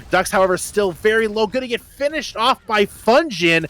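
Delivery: rising excited casting; live play-by-play. Real, not acted. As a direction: call the fight as it builds.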